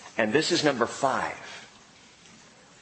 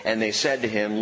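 usual speaking voice

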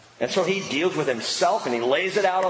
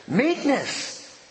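mocking high; 9 semitones higher than usual. Mocking the rallying word.

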